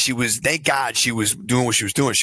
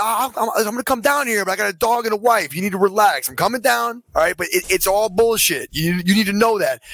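higher-pitched, agitated retelling; insistent protest; 8 semitones higher than usual. Retold or imitated. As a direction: argue back while agreeing to come down. Retold argument with police.

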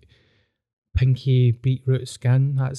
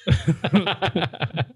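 a short falling laugh; 5 semitones higher than usual. Real, not acted. Laughing at his own rant about the heckler. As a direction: let a laugh slip out.

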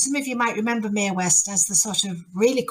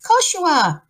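reassuring mum. Acted. Mum answering her son, reassuring sing-song. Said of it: "And she says".

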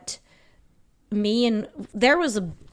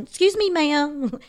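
imitated stranger calling; 6 semitones higher, slower than usual. Imitating man stopping her.

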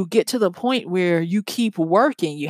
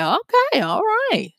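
mock cheery okay; 12 semitones higher than usual. Runner's inner voice on treadmill.

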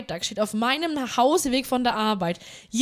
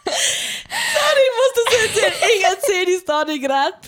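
high, loud helpless laughter; can't stop laughing while talking; laughing fit; 9 semitones higher, 6 dB louder than usual. Real, not acted. Breaks up laughing mid-story.

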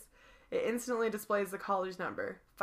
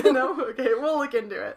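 laughing; 4 semitones higher, 10 dB louder than usual. Acted. Voices sergeant, laughing.

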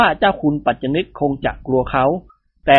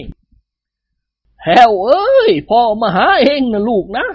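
higher, louder eerie wailing call; wailing ghost; 7 semitones higher, 4 dB louder, much slower than usual.

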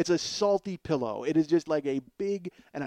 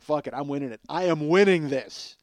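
determined; 6 dB louder than usual. Retold or imitated. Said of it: "So I was like".

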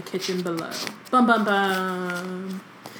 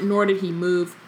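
ordinary talk, nothing marked

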